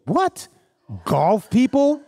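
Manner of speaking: high incredulous what, golf people; surprised. Real, not acted. Nurse reacting to her own golf list, surprised and high. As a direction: pitch it up in disbelief.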